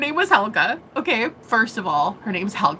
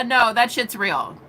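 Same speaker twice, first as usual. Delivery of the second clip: ordinary talk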